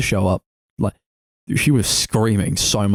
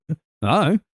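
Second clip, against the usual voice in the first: higher, put-on clueless voice; lightly mock their cluelessness, pitched up; clueless police; 6 semitones higher than usual. Voicing police ignoring the message, mock clueless. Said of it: "and they were like".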